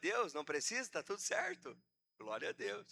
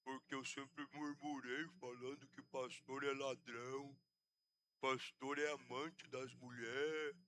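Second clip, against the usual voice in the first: low, slow, soft mumble imitating a stroke patient; barely manage to form the words; slurred stroke speech; 5 semitones lower, 8 dB quieter, slower than usual. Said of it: "Fala direito".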